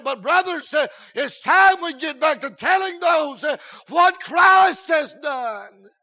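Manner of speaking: loud, slow, hammered words; revival-meeting climax; thundering preaching. Acted. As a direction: preach it at the top of your voice.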